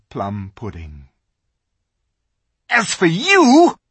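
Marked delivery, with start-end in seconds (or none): 2.7-3.8 high, loud, slow threat; menacing witch; witch queen. A narrator's reading. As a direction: turn coldly on the boy. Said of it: "said the witch".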